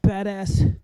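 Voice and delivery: slow, high, hammed-up boasting; sarcastic bravado. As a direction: puff it up, mock heroic. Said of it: "I'm being sarcastic here".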